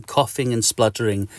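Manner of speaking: ordinary delivery, nothing marked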